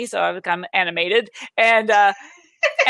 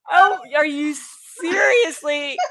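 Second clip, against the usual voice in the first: high, disbelieving question, laughter close by; pitched-up, halting mock astonishment; retold incredulity; 5 semitones higher than usual. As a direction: act out amused disbelief. Retold incredulous reply to scream.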